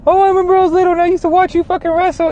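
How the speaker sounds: high, fast gushing fanboy voice; mock starstruck fan. Mocking a fan who gushes to Kurt Angle, high and fast. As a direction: squeal it like a giddy kid meeting his hero.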